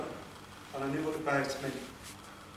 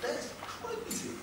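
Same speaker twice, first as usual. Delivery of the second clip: high breathless whisper, slow; whispered thought; 6 dB quieter, much slower than usual. Retold or imitated. Boy thinking to himself the morning after, a slow whisper. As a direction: breathe it as a private disbelieving thought. Boy's inner thought about breakfast.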